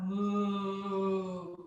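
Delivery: long, low cow moo. Shown as a demonstration. Yoga teacher looking up in cow pose, low mooing. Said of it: "make the moo sound like the cow".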